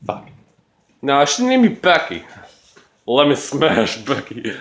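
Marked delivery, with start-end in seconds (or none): none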